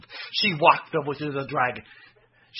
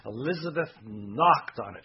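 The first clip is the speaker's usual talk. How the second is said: ordinary delivery, nothing marked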